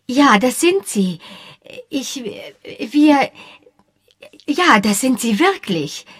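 high, halting, over-insistent agreement; slightly unsettled. A narrator's reading. Josie agreeing the Jeffersons are charming, unsettled and faltering. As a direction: falter mid-thought, then insist again. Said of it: "erwiderte Josie ein wenig verunsichert".

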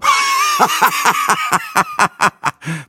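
loud, high burst of laughter; long braggart's guffaw at own joke; snorting guffaw. A narrator's reading. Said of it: "prustete Breitling". (prustendes Gelächter)

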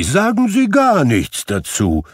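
reformed miser asking forgiveness; eager, humble Scrooge. Acted. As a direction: Scrooge begging pardon warmly. Scrooge waves off thanks.

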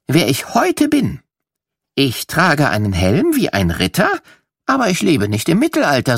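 ordinary delivery

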